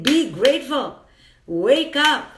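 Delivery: a rousing be grateful, wake up; urgent wake-up call. Shown as a demonstration. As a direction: shake them awake with it. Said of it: "I'm saying".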